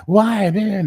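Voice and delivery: high, loud indignant outburst; angry students. Retold or imitated. Students angrily objecting to their teacher, high loud indignant cry. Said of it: "They'd get all angry".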